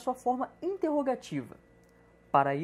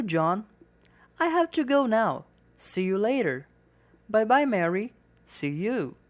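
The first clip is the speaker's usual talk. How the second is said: clear, slightly louder reading of both parts; dialogue read for learners; 5 dB louder, slower than usual. Acted. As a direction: read the dialogue aloud as a model for students. (leitura de diálogo para alunos)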